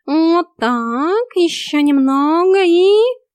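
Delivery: high, loud, building suspense; rising, stretched encouragement; goading cat coach. A narrator's reading. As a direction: draw it out up to the cliffhanger. Cat eggs on the reaching narrator.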